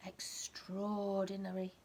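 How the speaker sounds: usual speaking voice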